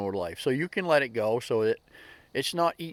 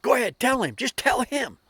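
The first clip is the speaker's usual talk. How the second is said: put-on high, loud older lady urging her friend; bickering older lady; 11 semitones higher, 5 dB louder than usual. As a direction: egg her on in a high, insistent squawk. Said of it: "just a bickering back and forth".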